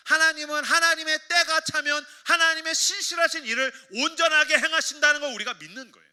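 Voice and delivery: loud, high sustained sermon climax; ringing pulpit proclamation; impassioned preaching. Real, not acted. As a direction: proclaim it with full conviction.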